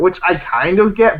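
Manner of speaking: ordinary delivery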